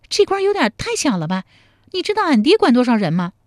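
put-on high, quick playful young woman; girl's teasing voice. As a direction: tease him in a bright girlish voice. 陶三春 voiced by narrator. (少女俏皮地调侃)

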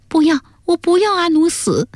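very high-pitched distraught daughter; tearful pleading. A narrator's reading. Daughter refusing to poison her husband, tearful pleading. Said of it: "哭着说".

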